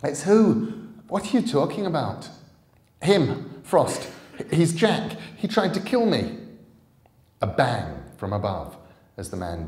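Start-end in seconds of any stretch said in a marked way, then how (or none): 0.0-2.3 put-on much higher, louder puzzled girl; girl questioning her friend; confused girl. 3.0-6.4 put-on much higher, louder urgent boy; boy warning his friend.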